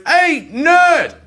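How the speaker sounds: high-pitched jeering holler; mock bully call. Bully to nerd at bus stop, taunting holler. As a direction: imitate a bully hailing his target.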